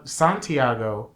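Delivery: ordinary delivery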